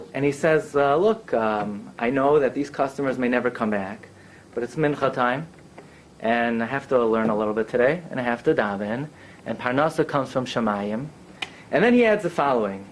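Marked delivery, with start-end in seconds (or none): 1.0-11.0 low, subdued, patient butcher; quietly resigned, lower pitch; calm explaining. Retold or imitated. Butcher calmly explains himself to the doctor.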